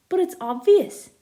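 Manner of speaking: loud, high exasperated cry; exasperated exclaim. Acted. Jake to Felix, loud and exasperated. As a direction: throw up your hands at the obvious.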